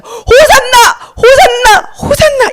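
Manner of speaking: high, drawn-out acclaiming cry; slow, high-pitched shout; crowd shouting. Acted. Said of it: "이 사람들이 이렇게 외쳤어요".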